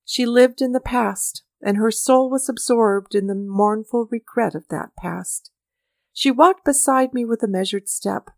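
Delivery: plain reading voice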